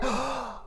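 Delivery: put-on sharp child gasp; excited gasp. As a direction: gasp at the surprise. Boy sees the gift.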